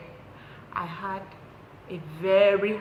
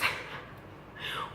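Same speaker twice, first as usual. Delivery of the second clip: real, breathless little laugh; soft laugh escaping at a sad moment; brief laugh; 7 dB quieter than usual. Real, not acted. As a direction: laugh despite yourself. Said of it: "Why am I laughing?".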